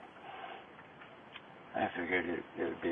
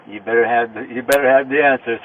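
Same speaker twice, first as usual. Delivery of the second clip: louder, pressing each word home; emphatic warning; 2 semitones higher, 9 dB louder than usual. Real, not acted. To the detectives about judgment day, a loud emphatic warning. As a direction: drive the warning at them.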